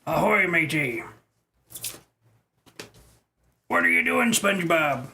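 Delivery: gruff cartoon pirate voice; pirate impression. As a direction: growl it like a sea captain. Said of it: "that's not a good impression".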